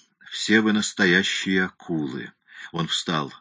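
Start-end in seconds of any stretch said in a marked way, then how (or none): none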